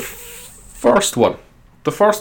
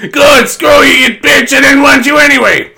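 furious yell; 9 dB louder than usual. Retold or imitated. Sandler character screaming at his girlfriend, a mock yell. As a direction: blow up at her, hammed-up. Happy Gilmore yelling at girlfriend.

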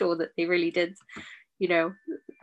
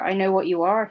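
ordinary delivery

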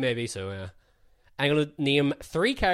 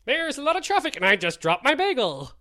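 high-pitched traffic reporter voice; cartoon airborne reporter impression; Arnie Pye; 9 semitones higher than usual. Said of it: "Artie Pie in the sky".

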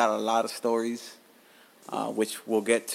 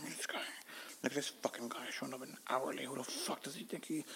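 hushed imagined gossip; 3 semitones higher, 13 dB quieter than usual. Retold or imitated. Staff gossiping about the late man, hushed and snide. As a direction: mutter it like coworkers gossiping behind his back. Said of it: "I said what I thought they were saying".

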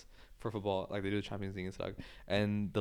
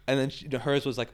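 ordinary delivery

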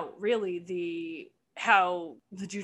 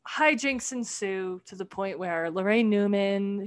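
normal talking voice

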